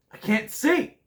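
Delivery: high, loud mock complaint; hammed-up squeal of protest; playful outcry. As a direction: cry out in pretend panic. Playful outburst while picking next film.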